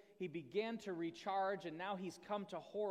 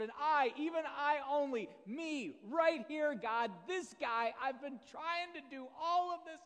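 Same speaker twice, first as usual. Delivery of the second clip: a prophet venting to God till he's spent; exasperated rant; 7 semitones higher, 7 dB louder than usual. Acted. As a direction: pour out your grievances, louder and louder. Elijah's rant building to burnout.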